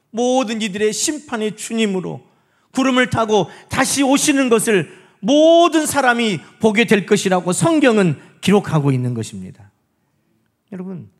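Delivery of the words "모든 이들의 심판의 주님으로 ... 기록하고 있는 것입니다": loud, emphatic pulpit proclamation; forceful preacher's climax; impassioned preaching. Real, not acted. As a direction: drive the sermon home with full force. Preaches the second coming at full force.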